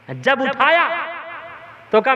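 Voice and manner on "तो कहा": ordinary talk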